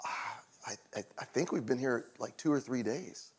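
faint, breathy half-whisper, unsure; dazed whisper. Retold or imitated. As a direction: whisper it like you can barely recall. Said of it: "He goes".

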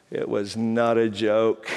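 slow, low and grave; grave reversal. Real, not acted. Preacher undercutting his own thinking this is a joke, gravely. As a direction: drop the pitch and slow down solemnly. Punchline reversal after retold teasing.

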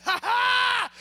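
high, loud hooting laugh; mocking laugh. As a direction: burst into a teasing cackle. Mocks Peter for being roasted.

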